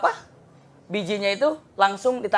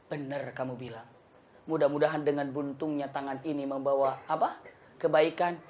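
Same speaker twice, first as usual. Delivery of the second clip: much lower, softer admission; humbled king; 6 semitones lower, 8 dB quieter than usual. Acted. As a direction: concede it quietly, humbled. King admits servant was right.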